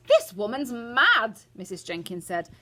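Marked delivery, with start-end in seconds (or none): 0.1-1.4 loud, slow and high; indignant mother dismissing a madwoman; affronted. A narrator's reading. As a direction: declare it in outrage. Affronted mother in read quarrel.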